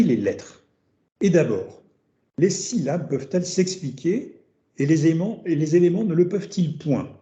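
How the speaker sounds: measured reading voice for Socrates; reading a text aloud. Acted. Teacher reads Socrates' words to students, measured reading. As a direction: read the dialogue line out to the class.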